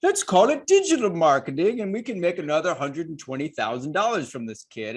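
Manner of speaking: hammed-up money-hungry college official; mock college schemer. Colleges plotting to make money from a kid, hammed-up scheming.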